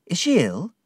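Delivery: high, anxious question; alarmed question. A narrator's reading. Narrator asks Ham whether Emily is ill, alarmed. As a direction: ask with rising alarm. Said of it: "I cried".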